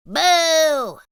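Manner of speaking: loud boo; cried boo. Acted. Plank scaring Splat, a loud boo. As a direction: shout boo to scare him. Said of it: "cried Plank".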